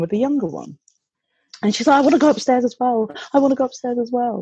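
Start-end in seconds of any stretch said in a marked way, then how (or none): 2.0-4.4 higher child voice, lightly imitated; copycat little sister. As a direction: mimic a younger girl insisting on following. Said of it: "like this".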